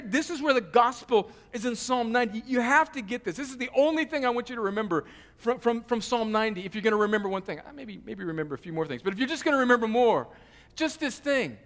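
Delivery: ordinary talk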